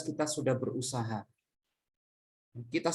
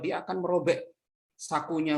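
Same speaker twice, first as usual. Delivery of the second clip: ordinary delivery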